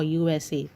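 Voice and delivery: "U S A" said letter by letter; name each letter in "U S A"; spelled out. Shown as a demonstration. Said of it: "you say it as A T M or".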